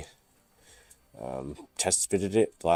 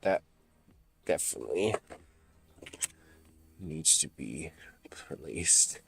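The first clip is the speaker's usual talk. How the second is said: whisper; 3 semitones lower, 8 dB quieter than usual. Real, not acted. Quiet aside under breath.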